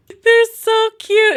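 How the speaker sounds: high, slow, loud gush; delighted high squeal; cute squeal. Real, not acted. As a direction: coo over the puppies. Gushing over puppies.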